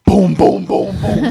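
high, rhythmic booms; imitated door banging.

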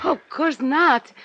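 high, loud, slow protest; Oh, of course not, shocked and stung; shocked denial. Acted. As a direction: recoil at the accusation.

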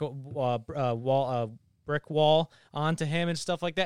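usual speaking voice

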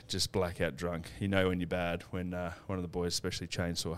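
ordinary talk, nothing marked

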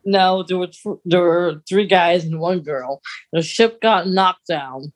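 ordinary talk

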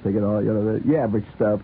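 ordinary delivery

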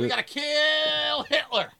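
high, loud mock yell with KILL dragged out; drawn-out, hammed-up action-hero cry; slow, overblown star impression at high pitch; Tom Cruise. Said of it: "he's Tom Cruise doing Tom Cruise shit".

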